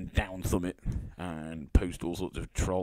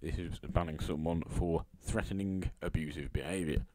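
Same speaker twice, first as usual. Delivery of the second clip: ordinary talk, nothing marked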